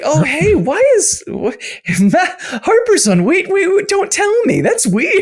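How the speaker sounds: high, slow, confused put-on voice; flustered mock guest. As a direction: play a startled guy on a show. Imitating Harper flustered on Dropout.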